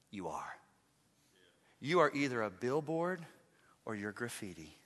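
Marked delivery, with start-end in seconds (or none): 0.1-0.6 much lower, softer "You are"; quiet weight on "You are"; hushed insistence. Real, not acted. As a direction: drop "You are" to a near hush. Same words repeated quietly.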